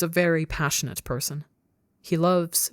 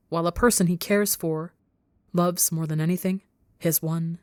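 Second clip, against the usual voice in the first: usual speaking voice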